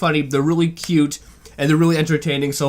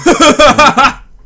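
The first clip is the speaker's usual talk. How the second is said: a burst of high loud laughter; 10 semitones higher, 9 dB louder than usual. Real, not acted. Reacting to the video, bursting out laughing. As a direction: crack up loudly at the joke.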